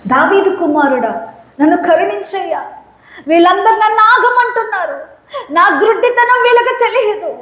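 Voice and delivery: lightly voiced blind beggar's plea; blind man pleading. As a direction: climb with each line. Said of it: "blind man voiced again".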